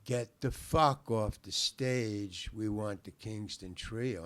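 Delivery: very slow, deliberate order; slow menace. Retold or imitated. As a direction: spell out the threat word by word. Menacing heckler comes up with bottle.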